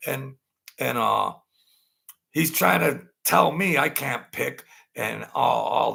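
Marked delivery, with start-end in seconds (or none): none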